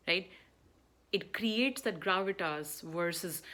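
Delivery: ordinary delivery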